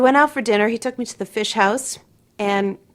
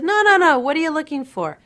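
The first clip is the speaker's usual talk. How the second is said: higher, louder, won't take no; mock insistent matchmaker, pitched up; insisting matchmaker; 6 semitones higher, 5 dB louder than usual. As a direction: push the question back at him. Matchmaker insists a second time.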